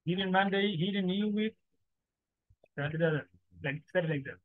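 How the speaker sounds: ordinary talk, nothing marked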